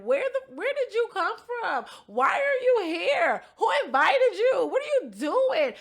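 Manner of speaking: high, indignant mock shouting; imitated drunk yelling. Storyteller acting out hostile questions at an unwelcome housemate, high mock yelling. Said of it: "She's yelling. She's drunk as shit".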